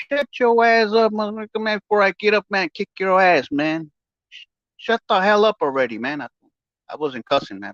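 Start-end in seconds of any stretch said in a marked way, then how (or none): 0.1-6.2 mock shouted threat; retold angry threat. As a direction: imitate telling him off hard.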